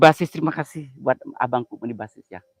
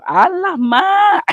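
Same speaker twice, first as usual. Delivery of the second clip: much higher, louder alah mak; shrieking exclamation; 11 dB louder, much slower than usual. Real, not acted. Streamer reacting to a teasing line, loud high squeal. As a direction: squeal it out in shock.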